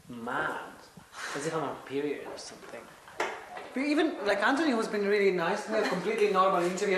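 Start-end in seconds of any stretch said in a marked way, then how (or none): 3.7-7.0 laughing disbelief. Real, not acted. Laughing about crying in interview.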